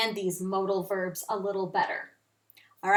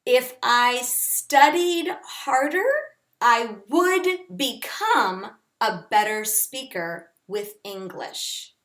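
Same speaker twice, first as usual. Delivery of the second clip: slow, careful example sentence; 4 dB louder, slower than usual. Shown as a demonstration. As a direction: spell it out slowly for students. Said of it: "you could say something like".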